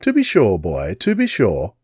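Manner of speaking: English lady, lightly amused; Englishwoman. Acted. Englishwoman answering Jupiter, English accent. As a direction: agree airily, English accent. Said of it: "the woman said".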